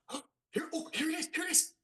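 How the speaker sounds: high, soft rising anticipation; whisper-squeal as your scene comes; hushed excitement. Retold or imitated. The blacksmith watching the show with his wife Lennon, soft high excited anticipation. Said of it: "and I'm like".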